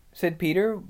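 usual speaking voice

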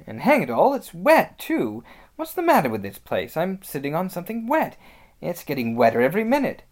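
lively, quick-fire character complaint; complaining Peter. Acted. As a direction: grumble with rising alarm about the damp.

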